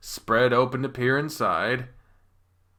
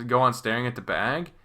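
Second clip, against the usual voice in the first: ordinary delivery, nothing marked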